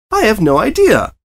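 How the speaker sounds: high, loud cheerful pony voice; happily clueless pony chirp; beaming Pinkie. A narrator's reading. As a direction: say it with a huge grin. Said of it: "Pinkie beamed".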